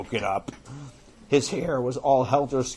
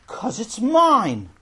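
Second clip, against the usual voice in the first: high, loud, drawn-out whine; possessive protest; 9 semitones higher, 6 dB louder, much slower than usual. Acted. Boy refusing to share his game, high stubborn protest.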